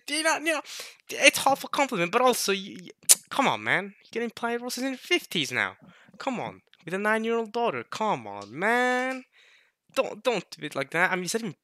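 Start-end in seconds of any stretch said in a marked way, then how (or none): none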